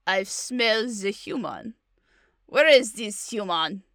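slow mock Russian accent; Baba Yaga. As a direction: act a Russian villain sniffing out prey. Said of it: "Let's hear your Baba Yaga".

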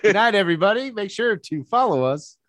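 jokey high outro voice, as if ending the episode; mock show sign-off.

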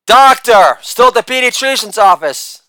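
ordinary delivery

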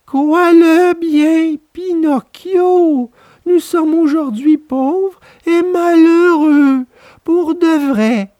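high, slow pleading whine; the fox pleads with Pinocchio, slow whine; whining fox. A narrator's reading. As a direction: beg as if starving. Fox begging slowly.

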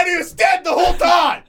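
high, loud laughing shout; mock spoiler shout. Host jokingly shouting a fake spoiler at listeners. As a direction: blurt the spoiler out as a joke. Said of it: "I'm gonna randomly shout".

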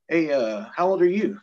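loud, high officer's question; captain calling out. Retold or imitated. Captain asks the other guy his age, calling out loudly. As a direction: call across to the next man.

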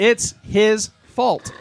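drawn out and emphatic; emphatic, slow. Real, not acted. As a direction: slowly hammer each word home. Ranting about Robin Leach.

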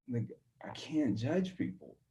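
soft whisper of a private vow; whispered to self. Retold or imitated. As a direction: breathe it as a note to yourself. Private resolve after humbling moment.

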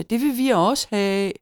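put-on high, envious kid voice; children whining. A narrator's reading. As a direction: whine for it like a child. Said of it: "sagde børnene".

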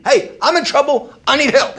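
loud, high plea for help; urgent appeal. Acted. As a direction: call out urgently for rescue. Acts out an appeal to God.

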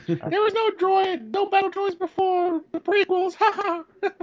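high cartoon mouse voice. Continuing Mickey voice.

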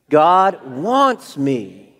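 loud, slow conviction on "God wants me"; like you mean it. Shown as a demonstration. Preacher urging congregation to mean it, loud and slow. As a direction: proclaim it firmly, each word drawn out. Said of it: "Now say it like you mean it".

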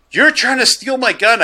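high-pitched mock accusation; mock indignant. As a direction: accuse like an outraged customer, retold lightly. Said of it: "he gets all mad at me".

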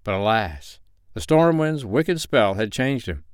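ordinary delivery, nothing marked

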